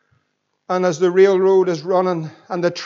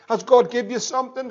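ordinary delivery

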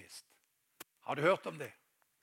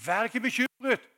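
loud, high proclamation; emphatic command; 9 semitones higher, 10 dB louder than usual. Acted. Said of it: "Han sier". (høy, kraftfull formaning)

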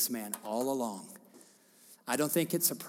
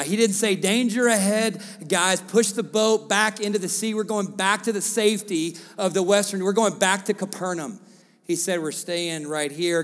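loud, higher commanding preaching; full-force preaching; 7 semitones higher, 10 dB louder than usual. Acted. Pastor proclaiming Jesus's command, loud preaching. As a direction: declare it over the room.

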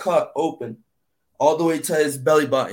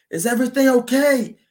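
screamed question; 9 semitones higher than usual. Retold or imitated. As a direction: yell it up to the lookout. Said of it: "I just screamed".

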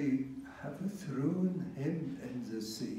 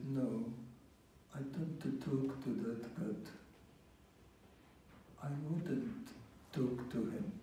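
lower, softer stubborn refusal; sullen Jonah; 4 semitones lower, 8 dB quieter, faster than usual. Acted. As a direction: mutter it like a sulking prophet.

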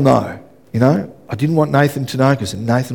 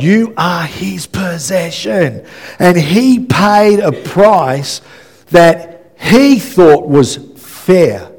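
slow, stretched declaration with raised pitch; emphatic preaching; 4 semitones higher, 3 dB louder, much slower than usual. Real, not acted. Preacher telling congregation they are God's possession, slow emphatic proclamation. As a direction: proclaim it word by word, drawing each out.

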